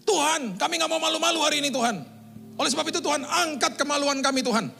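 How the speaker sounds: high, loud and fast, fired up; fervent prayer. Retold or imitated. Worship leader praying over the congregation, high, loud and fast. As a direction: pray at full zeal.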